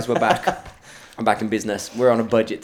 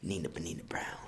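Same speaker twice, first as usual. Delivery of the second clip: whispering; 6 semitones lower, 14 dB quieter than usual. Retold or imitated. Retelling a secretive invitation.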